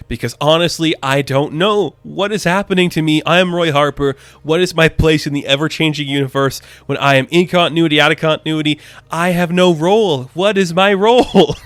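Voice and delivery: agonize theatrically, stretching the final words; mock existential anguish. Hero's exaggerated existential rant, then laughs.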